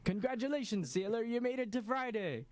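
high-pitched imitation of a cartoon meme; Mister Krabs. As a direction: hail the crew in a high cartoon voice. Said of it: "the Mister Krabs one".

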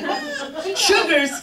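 laughing disbelief; can't believe the name; incredulous. Retold or imitated. Grandma reacting to the dog's name, laughing disbelief. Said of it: "And I'm like".

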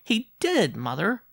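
put-on high, loud child's voice; insistent boy. A narrator's reading. Boy insisting to his mother.

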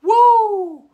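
loud, high whooping woo; excited whoop. Retold or imitated. Reacting to a mind-blowing idea with a whoop. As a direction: let out a big amazed whoop. You just sit back and go.

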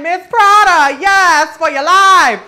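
high, loud hyped shout-out; sassy drag queen. Pretend. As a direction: hype her like a drag emcee. Hyping Miss Prada.

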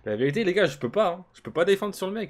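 frustré. Real, not acted. Plainte au public.

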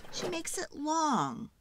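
plain reading voice

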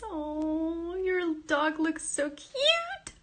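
high, slow cooing voice on cute. Real, not acted. Streamer coos over a viewer's dog photo, high baby talk.

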